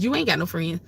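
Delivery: normal talking voice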